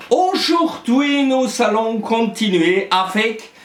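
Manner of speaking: normal talking voice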